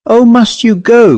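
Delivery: high, quavering and doleful; a lonely old woman's doleful quaver. A narrator's reading. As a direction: plead sadly, voice trembling. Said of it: "quavered Miss Lefin dolefully".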